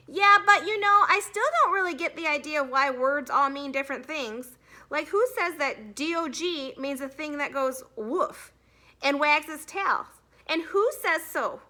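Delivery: lightly voiced eager kid; eager boy. A narrator's reading. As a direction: bait the teacher innocently. Boy asks baiting question.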